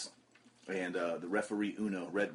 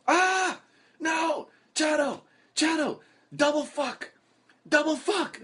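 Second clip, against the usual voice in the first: much higher, louder panicked shouts; imitating Uno yelling; 7 dB louder, much slower than usual. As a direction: wail as the hat flies out the window. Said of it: "I hear him go".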